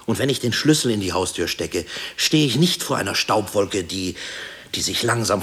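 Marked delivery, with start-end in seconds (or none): 0.0-3.8 raised, loud, insistent listing; impassioned, louder and higher declaration; fervent affirmation. Acted. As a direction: build it up, insisting each thing still stands. Fervently affirming the world still stands.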